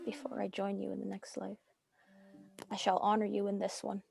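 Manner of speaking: soft, solemn promise; hushed vow. Acted. Widow holding the dagger and vowing softly to her dead husband. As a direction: murmur it like a sacred oath.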